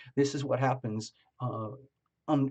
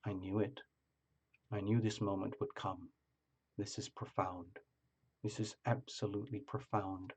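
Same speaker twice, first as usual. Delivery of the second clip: soft, lower, hushed certainty; solemn knowing; 4 semitones lower, 11 dB quieter than usual. Retold or imitated. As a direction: murmur it as if fate just arrived. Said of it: "your energy would be like".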